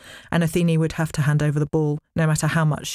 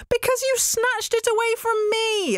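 put-on very high childish wail; crying out. A narrator's reading. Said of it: "Athene cried".

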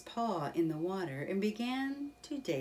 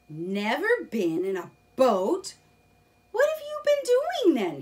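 loud and much higher, amazed disbelief; astonished Rat; 8 semitones higher, 10 dB louder than usual. Acted. Rat amazed that Mole never boated, loud high disbelief. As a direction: squeak out the question in disbelief. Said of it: "he asked".

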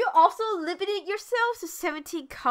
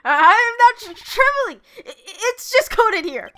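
high, loud, stammering denial; acted jittery defensiveness; nervous trembling; 7 semitones higher, 6 dB louder than usual. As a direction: stammer out a flustered, high-pitched protest. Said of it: "If you keep trembling like that".

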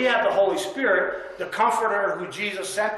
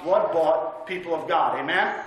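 ordinary delivery

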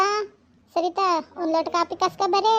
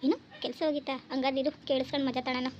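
hushed, much softer gloating aside; sneaky aside; 2 semitones lower, 8 dB quieter than usual. Acted. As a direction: gloat under your breath so nobody hears. Gleeful eavesdropping aside. (ಮನೆಯವರಿಗೆ ಕೇಳದಂತೆ ಸೊಸೆಯ ಮೆಲ್ಲನೆ ಖುಷಿಯ ಮಾತು)

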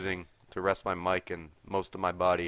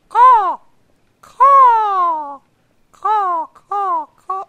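very high, loud crow cawing; shrill bird caws; 17 dB louder, much slower than usual. Pretend. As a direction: squawk like a crow. Imitating crows.

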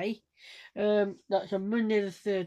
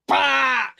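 loud, high burst; loud demo "bah"; 5 semitones higher, 8 dB louder than usual. Shown as a demonstration. Vlogger showing laughs, one loud high burst. Demonstrating another laugh noise.

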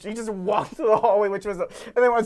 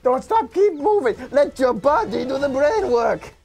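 loud, higher Jamaican-accented cartoon voice; Funky, Jamaican accent; 5 semitones higher, 5 dB louder than usual. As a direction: call it out with a lively Jamaican accent. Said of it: "the Jamaican accent character was funky".

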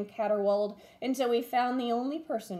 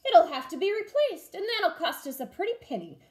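higher, louder pirate grumble; pirate captain; 8 semitones higher, 5 dB louder than usual. A narrator's reading. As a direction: complain about the cost like a captain. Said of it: "Braidbeard said".

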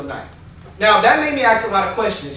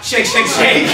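louder, fuller repeated chant; out-loud bathroom chant; slower than usual. Retold or imitated. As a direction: announce it for the next man to hear. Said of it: "I said it out loud".

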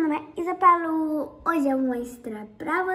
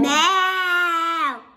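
loud; 3 semitones higher, 7 dB louder than usual. Real, not acted. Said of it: "Ninguém ouviu, não?".

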